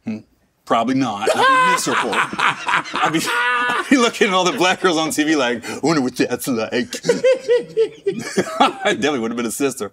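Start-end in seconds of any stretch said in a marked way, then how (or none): 1.3-2.1 says it through a big laugh. Real, not acted. Joking to the host that he'd be miserable, laughing. 2.1-9.9 laughing. Real, not acted. Joking about his alternate life.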